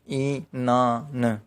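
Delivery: Inan said very slowly, in-na-n, syllable by syllable; very slow demo of Inan; slow syllable count. Shown as a demonstration. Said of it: "यानी तीन syllable हो गए".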